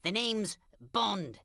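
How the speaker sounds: slow, low, bad British accent; terrible British accent. Larry posing as a spy to inspire Archibald, bad British accent. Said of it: "that's a terrible British accent".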